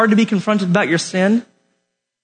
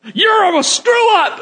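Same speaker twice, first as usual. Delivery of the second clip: mock yelled insult; 10 semitones higher, slower than usual. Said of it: "you're yelling at people".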